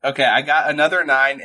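normal talking voice

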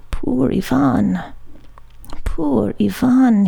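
slow, pained groaning lament; a stabbed sailor pitying himself. A narrator's reading. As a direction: drag it out, wounded and failing. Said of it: "he groaned".

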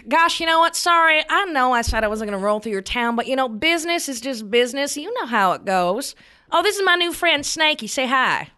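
high, fast breezy mock apology; sassy taunt. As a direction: toss it off smugly. Debbie taunting father in character.

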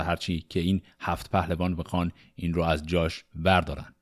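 ordinary delivery

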